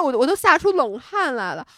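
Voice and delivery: usual speaking voice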